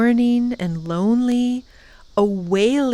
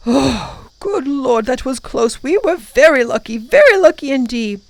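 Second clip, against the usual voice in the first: put-on relieved old professor; 5 semitones higher, 5 dB louder than usual. A narrator's reading. Professor relieved after danger.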